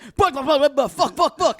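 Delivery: high-pitched mock swearing fit; loud, high, comic meltdown; flipping out. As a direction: imitate someone upset, cursing on repeat. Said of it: "And he's flipping out".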